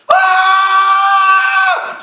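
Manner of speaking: long, high scream of pain. Acted. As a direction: cry out loud and sustained, needle in the foot. Acting out stepping on needle.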